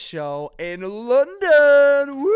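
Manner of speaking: excited announcement, then cheer; excited build-up. Real, not acted. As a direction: hype the London show. Announcing biggest show.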